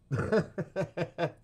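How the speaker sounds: high, loud burst of giggles; real high-pitched laugh. Real, not acted. Streamer tickled by too much information, laughing. Laughs at three S's joke.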